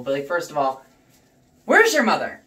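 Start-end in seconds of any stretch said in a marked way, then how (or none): 1.6-2.4 louder and higher, like a scolding parent; scolding demand. Real, not acted. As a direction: demand it sharply. Scolding racists.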